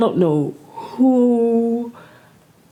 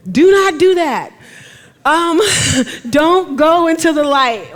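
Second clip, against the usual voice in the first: laughing shout; 9 semitones higher, 8 dB louder than usual. Real, not acted. Urgent warning, laughing.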